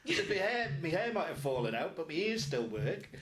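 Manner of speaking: loud, high, comic protest; mock indignant retort. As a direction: answer back loudly in mock outrage. Retold retort to whispering student.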